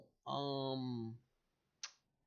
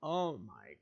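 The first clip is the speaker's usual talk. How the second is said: usual speaking voice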